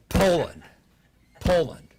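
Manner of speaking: firm insistence. Retold or imitated. Speaker as pope overruling his worried advisers, insistent. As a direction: punch each word and let it drop.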